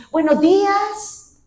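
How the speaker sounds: high, slow sing-song Buenos días with the pitch climbing up and falling down; overdone melodic shop greeting; singsong greeting. Shown as a demonstration. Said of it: "Está subiendo y está bajando".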